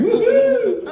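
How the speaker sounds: high, ecstatic woo-hoo; excited whoop. Acted. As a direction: cheer like you hit the ceiling. Cheering while standing on chair.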